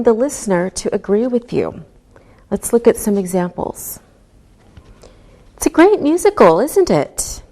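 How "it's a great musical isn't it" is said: tag question with the voice falling on isn't it. Shown as a demonstration. Teacher demoing a falling tag for the class. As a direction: let isn't it drop, inviting agreement. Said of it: "If your voice goes down".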